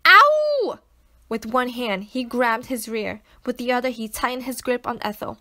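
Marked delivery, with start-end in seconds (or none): 0.1-0.8 high, loud yelp of pain; scream. Acted. Doctor yelping when the slingshot pebble hits him, loud. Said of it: "Zorin screamed".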